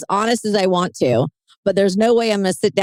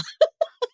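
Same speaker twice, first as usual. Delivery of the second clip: teary laugh. Real, not acted. Tearful moment, laughs it off.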